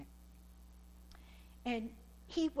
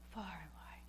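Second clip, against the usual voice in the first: unvoiced whisper of far and wide; whispered echo; 4 semitones lower, 12 dB quieter than usual. Preacher echoing the testimony's reach, whispered. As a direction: breathe it out as a hushed echo.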